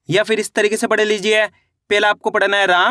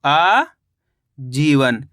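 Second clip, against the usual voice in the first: आजीवन as a long rising आ, a pause, then जीवन; slow split reading; 5 semitones lower, much slower than usual. Shown as a demonstration. Teacher reading back the finished word, slowly split in two. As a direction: stretch the first sound of आजीवन, then finish it separately. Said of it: "तो मिलके बन गया".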